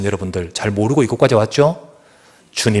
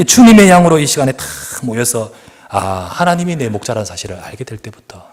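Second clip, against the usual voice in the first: ordinary talk, nothing marked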